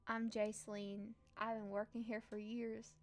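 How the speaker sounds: put-on country accent for a long-serving servant; Jaceline. Servant introducing herself to the newcomer, country accent. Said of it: "using a country accent for her".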